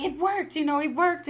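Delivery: high, loud cry of triumph; shouting that it worked, up high; excited cry. Retold or imitated. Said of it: "So he's like".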